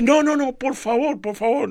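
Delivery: a hint of a kind lady pleading; lightly voiced insistent lady. (la señora amable insiste al hombre en el estacionamiento, voz más aguda e insistente)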